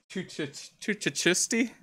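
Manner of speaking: high, hesitant stabs at CHISTI; tongue-tied stumbling. Real, not acted. Commentator retrying a weird team name, tongue-tied. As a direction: stammer on CH, then land a questioning Chisti. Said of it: "weird name just to give me a hard time".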